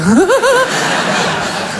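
very high, drawn-out giggle; mocking laugh. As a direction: imitate a wife's high laughing fit. Wife's reaction in the story.

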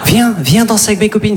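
high, laughing mock girl; a girl inviting a shy boy to dance, playful; girl's voice. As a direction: invite him to dance, girly and bubbly. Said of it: "qu'une fille vienne te voir".

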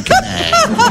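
high squeal of laughter on "un canard"; bursting laugh. Real, not acted. Teller laughing at his own duck joke. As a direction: crack up on the last word.

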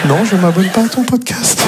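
high, indignant comic protest; mock-outraged rebuff; exasperated refusal. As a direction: refuse it high, thoroughly fed up.

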